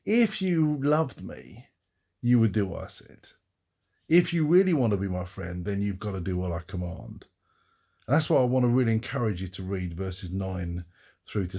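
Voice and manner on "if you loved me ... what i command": manipulative, coercive. Acted. God misheard as coercing his followers, manipulative. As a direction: lean on them with mock emotional blackmail. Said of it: "God is somehow manipulating us, somehow coercing us".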